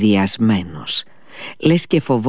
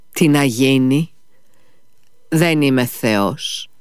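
indifferent; 3 semitones higher, slower than usual. A narrator's reading. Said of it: "είπε ο γιατρός αδιάφορα".

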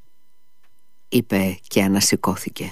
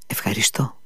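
whispered thanks; 6 dB quieter than usual. Acted. Liza's broken thanks.

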